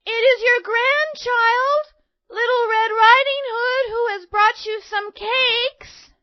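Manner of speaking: put-on very high, slow little-girl call. A narrator's reading. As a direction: call through the door as a small girl. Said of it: "But she called out".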